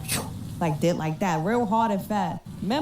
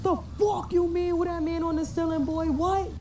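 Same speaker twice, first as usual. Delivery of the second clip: freaked-out disbelief; 8 semitones higher than usual. Retold or imitated. Son sees man on ceiling.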